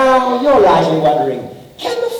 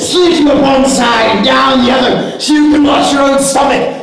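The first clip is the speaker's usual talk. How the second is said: menacing shout; 6 semitones higher, 3 dB louder than usual. Acted. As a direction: roar the threat with relish. Pirate threatens victim.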